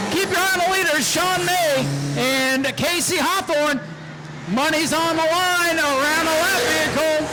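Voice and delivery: fast, high, rising race commentary that swells to shouts at the finish; race call. Real, not acted. Track announcer calling the last lap to the crowd, excited shouting.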